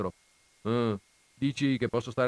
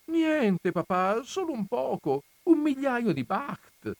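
put-on high, slow sheepish little boy; sheepish child; 11 semitones higher, slower than usual. A narrator's reading. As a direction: play it down like a caught kid. Son downplays his mistake.

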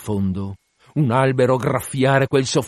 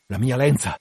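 restrained, disbelieving repeat; contained first reaction; stunned echo. Acted. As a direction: take it in at ordinary volume. Captain takes in the news.

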